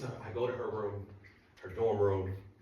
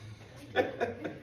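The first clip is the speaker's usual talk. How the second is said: laughter; 13 semitones higher than usual. Real, not acted. Audience laughs at low GPA.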